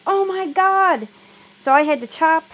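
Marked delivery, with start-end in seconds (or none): 0.1-1.1 high, slow, drawn-out oh my God; a stunned slow gasp of oh my God; dismayed realization. Retold or imitated. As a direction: stretch it out in sudden horror at the clock. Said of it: "I was like".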